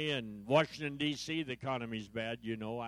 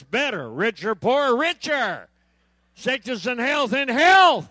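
loud, high, eager vow answers; loud excited mock vows; eager bride answers; 8 semitones higher, 13 dB louder, slower than usual. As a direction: shout each answer like a keen bride. Acted bride answering vows loudly.

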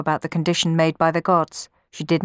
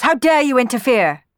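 loud, higher challenge; indignant shout; 7 semitones higher, 6 dB louder than usual. A narrator's reading. Orpheus to the interfering stranger, angry shout. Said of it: "shouted Orpheus".

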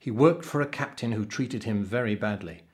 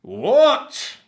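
very high, very loud roar of disbelief; explosive captain's bellow; outraged roar; 12 dB louder than usual. A narrator's reading. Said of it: "roared the captain".

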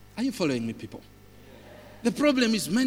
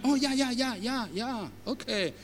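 put-on older passenger; 6 semitones higher, 4 dB quieter than usual. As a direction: imitate an old person nodding along, voice pitched up. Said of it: "one of them would say".

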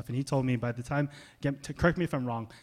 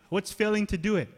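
usual speaking voice

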